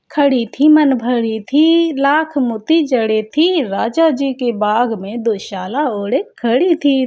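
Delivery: put-on lilting children's rhyme, punched at the start; playful rhyme recital. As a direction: recite it like a riddle, stretching the beats. Recites a remembered riddle rhyme.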